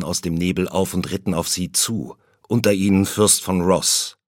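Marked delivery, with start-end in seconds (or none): none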